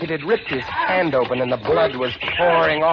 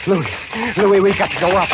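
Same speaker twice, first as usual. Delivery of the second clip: urgent; 6 semitones higher than usual. Acted. Urging escape.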